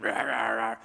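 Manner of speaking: low mock demonic "rah, rah, rah"; demon growl. Preacher imagining the unclean spirit, low growl. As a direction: growl it low like a possessed man. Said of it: "imagine if they said it in, like, a really".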